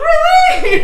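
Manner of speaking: high, delighted disbelieving squeak; surprised squeal. Retold or imitated. Retelling a fan compliment, surprised high squeal. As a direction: squeal it like you can't believe it.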